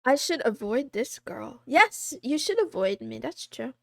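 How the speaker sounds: normal talking voice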